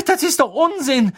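high, loud, impatient dismissal; indignant. A narrator's reading. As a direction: dismiss it crossly. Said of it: "sagte Hermine ungehalten". (ungehalten, mit erhobener Stimme)

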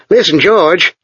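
pressing, hurried plea; a lightly urgent call to a friend. A narrator's reading. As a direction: grab his friend's attention, urgently. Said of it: "said Chuck urgently".

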